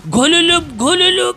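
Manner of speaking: phone ring noise. Pretend. Phone ringing imitation.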